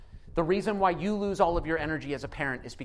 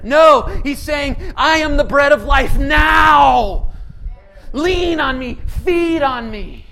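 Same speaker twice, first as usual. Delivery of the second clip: full-force preaching; 7 semitones higher, 10 dB louder than usual. Acted. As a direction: proclaim it to the hall.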